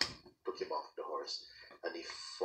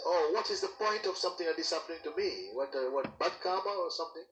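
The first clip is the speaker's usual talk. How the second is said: higher, plaintive complaint; lamenting king; 10 semitones higher, 3 dB louder than usual. Acted. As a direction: whine about your bad luck. King laments his injury.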